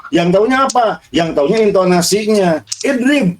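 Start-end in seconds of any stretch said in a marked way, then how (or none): 2.8-3.3 "Idrib" pitched up as an order; command intonation. Shown as a demonstration. Teacher shows students how an order sounds, command intonation on "Idrib". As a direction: say "Idrib" high, like a short command. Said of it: "ini intonasinya intonasi perintah begini".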